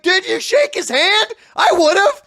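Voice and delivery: high, loud, slow disbelieving question; imitating his own past excited disbelief; incredulous excitement. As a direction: ask like he missed the chance of a lifetime. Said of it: "I was like".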